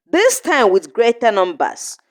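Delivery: ordinary delivery, nothing marked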